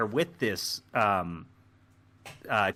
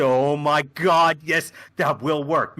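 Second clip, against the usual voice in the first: loud, drawn-out relief; excited realisation; 3 semitones higher, 6 dB louder, slower than usual. Acted. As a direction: seize on the idea with slow emphasis. Captain seizing on the idea.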